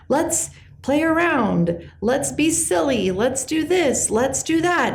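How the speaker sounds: high, slow, bouncy cartoon cheer; be Joy coaxing everyone to play; imitating bubbly Joy. Voicing Joy from Inside Out.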